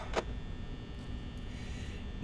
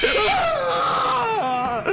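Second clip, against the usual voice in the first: scream into laughter; 5 dB louder than usual. Real, not acted. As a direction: laugh breaking into high scream. Jump scare reaction.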